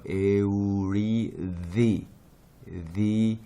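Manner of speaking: 에우리디 slowly, 디 as voiced "thi"; slow model of 디 with tongue-between-teeth th; Greek delta as TH. Shown as a demonstration. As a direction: stretch 에우리디, buzzing th on the 디. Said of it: "그래서 요 발음이에요".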